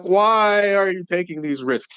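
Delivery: high, loud exasperated outburst; frustrated. Acted. Grinch scolding Cindy Lou Who for risks, frustrated. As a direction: plead in mock frustration. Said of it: "He's, like, frustrated with her".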